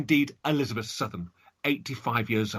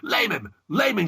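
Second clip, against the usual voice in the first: scream; 8 semitones higher, 4 dB louder than usual. Acted. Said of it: "she screamed".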